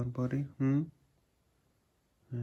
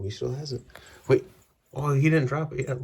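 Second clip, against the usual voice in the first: ordinary talk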